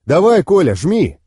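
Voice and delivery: loud, urgent order to drive; urgent command. Acted. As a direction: push the driver to floor it. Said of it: "велел я".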